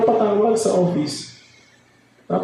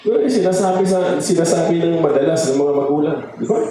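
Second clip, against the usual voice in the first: normal talking voice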